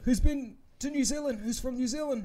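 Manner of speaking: imitating an eager host; mock crowd-work host. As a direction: play the keen host.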